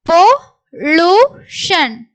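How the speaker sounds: slow syllables with gaps. Shown as a demonstration. Teacher sounds out the word for learners, syllable by syllable. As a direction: break "pollution" into three separate beats.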